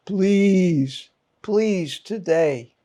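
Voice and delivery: normal talking voice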